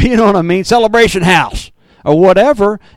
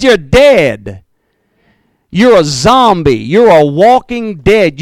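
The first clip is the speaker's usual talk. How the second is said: forceful preaching; 5 dB louder, slower than usual. Acted. Preaching Jesus' verdict.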